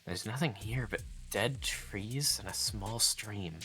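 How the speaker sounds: quiet, even narrator reading; story narration. A narrator's reading. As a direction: read the scene softly and steadily. Reading game narration aloud.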